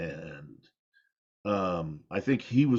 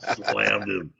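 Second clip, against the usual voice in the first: normal talking voice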